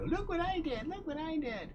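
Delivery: very high, squeaky mock excitement; childlike showing off. Artist putting scribble on the fridge, high childlike boast. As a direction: show it off in a tiny high voice.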